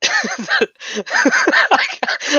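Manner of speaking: high-pitched, helpless laughter breaking up the words; laughing too hard. Real, not acted. Can't say the joke line for laughing, cracking up. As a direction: try to get it out through real laughter. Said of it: "I can't say it without laughing".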